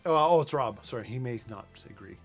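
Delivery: ordinary talk, nothing marked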